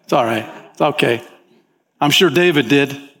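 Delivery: ordinary talk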